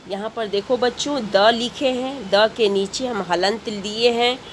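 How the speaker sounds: ordinary talk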